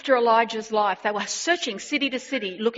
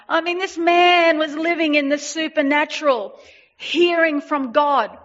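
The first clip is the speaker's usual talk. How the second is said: higher and louder, lifted preaching; excited praise; 6 semitones higher, 4 dB louder than usual. Real, not acted. Preacher marvels at Elijah living supernaturally, higher and louder. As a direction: lift it up in awe.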